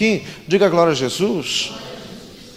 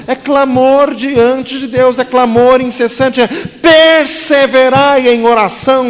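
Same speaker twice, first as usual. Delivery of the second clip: fervent preaching; 4 semitones higher, 7 dB louder than usual. Acted. Preaching at full force.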